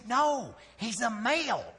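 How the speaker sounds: high, drawn-out comic correction; emphatic retort. Retold or imitated. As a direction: stretch it out high as an exasperated punchline. Acts his own emphatic retort.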